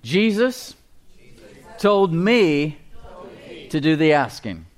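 short phrases with pauses for repeating; leading group repetition. Shown as a demonstration. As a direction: lead them in a declaration. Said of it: "Say this with me".

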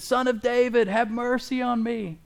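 high pleading call for mercy; blind beggar crying out. Retold or imitated. Blind man calling to Jesus, high pleading cry. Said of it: "he started yelling louder and louder".

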